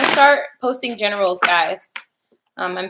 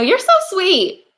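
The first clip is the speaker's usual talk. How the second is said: cooing thanks; 11 semitones higher, 4 dB louder, slower than usual. Acted.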